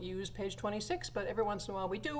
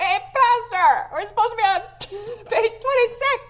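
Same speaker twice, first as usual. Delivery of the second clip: mock long-distance yell; 14 dB louder than usual. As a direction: pretend to shout down a huge echoey church.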